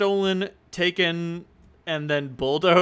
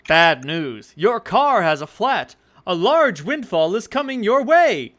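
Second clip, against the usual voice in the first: loud, high, pushy salesman pitch; used car salesman voice; 5 semitones higher, 6 dB louder than usual. Acted. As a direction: sell bad news like a cheesy car dealer. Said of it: "his used car salesman voice".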